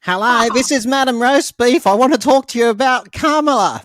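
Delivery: high, loud, chirpy doorstep pitch voice; hammed-up canvasser greeting a voter at the door; mock door-knocking canvasser. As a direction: play an overeager campaign canvasser at the door. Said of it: "What do they say to you?".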